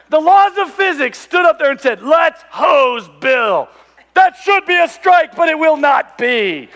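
loud, high, stretched-out proclamation; hammed-up shouted rant; mock tirade. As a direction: bellow it slowly in mock outrage. Mock tirade about the falling pins.

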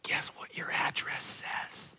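hushed, teasing whisper. Pretend. Preacher teasing the congregation, in a whisper. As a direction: whisper it like a secret.

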